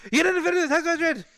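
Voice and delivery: high, rapid-fire angry yelling; imitating a caller screaming at him; screaming support guy. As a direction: scream the accusation in one garbled rush. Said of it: "guy from DoorDash screamed at me".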